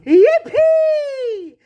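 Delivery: very high, loud, drawn-out whoop; jubilant yell. A narrator's reading. Grandpa Joe throwing up his arms, jubilant yell. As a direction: explode into a long, high yell of joy. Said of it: "and yelled".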